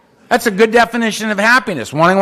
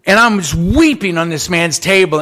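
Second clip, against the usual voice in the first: ordinary delivery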